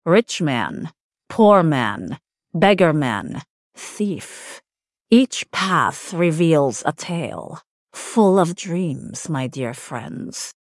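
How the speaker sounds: soft, slow rhythmic chant. A narrator's reading. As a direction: lull them with a slow rhyme. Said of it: "her voice soft and rhythmic".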